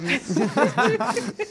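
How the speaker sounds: high burst of real laughter. Real, not acted. Laughter after the crunching.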